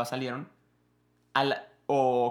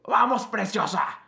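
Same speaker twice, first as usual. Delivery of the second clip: voicing a movie hero; 7 semitones higher, 5 dB louder, slower than usual.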